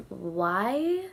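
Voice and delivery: low, soft, drawn-out questioning "why"; retold bafflement; confused. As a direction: stretch it out in bewilderment. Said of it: "I was so confused".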